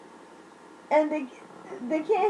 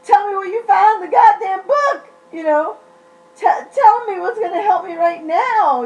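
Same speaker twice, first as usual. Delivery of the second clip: loud, higher pleading demand; raised, frantic plea; desperate demand; 6 semitones higher, 6 dB louder than usual. Retold or imitated. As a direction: beg loudly for the answer. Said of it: "I'm like".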